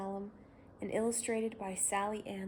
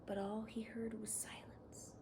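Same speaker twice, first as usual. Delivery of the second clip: plain reading voice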